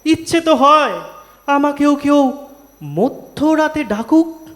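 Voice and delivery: high-pitched, emphatic rhythmic complaint; dramatic verse recitation. Acted. Poet voicing Tagore's birthday grievance to an audience, animated high-pitched recitation.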